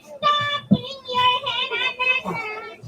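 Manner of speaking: high, drawn-out whine; mock whining. Shown as a demonstration. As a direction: whine it slowly like a pestering child. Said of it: "if you're gonna be whining".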